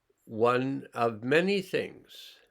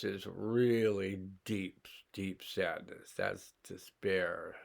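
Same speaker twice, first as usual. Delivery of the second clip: slow, much softer, sinking into sadness; 2 semitones lower, 4 dB quieter, slower than usual. Acted. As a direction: let the voice drop away as you sink into despair. Demonstrating dropping into deep sadness.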